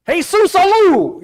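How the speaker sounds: high, loud and drawn out, hammed-up; mock oath. Smart-aleck kid repeating his oath to the teacher, hammed-up. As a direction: stretch the name out like a cheeky kid.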